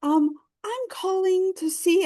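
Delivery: put-on very high, timid little-girl voice; little Girl Scout. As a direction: ask for business like a small scout. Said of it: "I could hear it in my voice".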